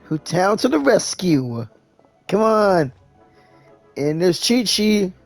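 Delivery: ordinary delivery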